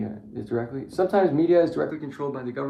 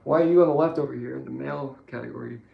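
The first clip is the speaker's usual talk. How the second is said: ordinary talk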